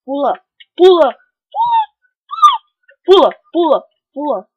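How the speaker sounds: urgent high yelled commands; frantic shouting. Real, not acted. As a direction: shout it in a panic. Frantic jumping in game. (gritando desesperado)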